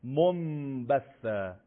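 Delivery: منبثا said slowly with m for n; iqlab demo. Shown as a demonstration. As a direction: lips meet gently in منبثا. (منبثا آهسته با میم)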